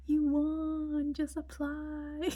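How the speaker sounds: high, drawn-out sing-out; gleeful announcement imitation. Anne tells the cellist about the scholarship, high imitation. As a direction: mimic her, high and stretching the last word slowly.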